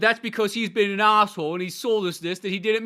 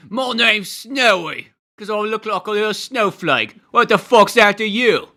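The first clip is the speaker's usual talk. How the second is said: slow, higher and louder bristling voice; tough-guy Snowy; 4 semitones higher, 7 dB louder, slower than usual. Retold or imitated. Imitating punk character Snowy challenging someone, slow loud tough-guy voice. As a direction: play a scrappy punk daring you to answer.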